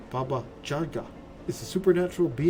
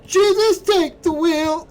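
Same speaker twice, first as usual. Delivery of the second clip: much higher, louder, slow belted plea; mock-dramatic cry; 12 dB louder, slower than usual. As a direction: belt it out like a desperate prayer. Dramatic plea before clicking.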